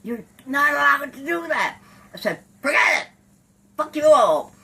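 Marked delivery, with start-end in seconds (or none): 0.0-1.8 high, loud voice of reproof; imitated scolding. Others scold her for cussing. 2.6-4.5 very loud, high defiant shout; retold cussing. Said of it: "I'm really cussing".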